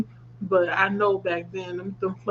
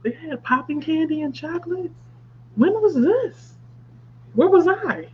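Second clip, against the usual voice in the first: high-pitched, incredulous questions; real disbelief; 8 semitones higher than usual. Real, not acted. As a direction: ask in amazed disbelief, voice jumping up. Surprised by candy in chocolate.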